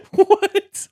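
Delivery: loud burst of laughter into a high what; laughing disbelief. Real, not acted. Host laughing at the broken line. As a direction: crack up in disbelief. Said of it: "Hold on, let's go back".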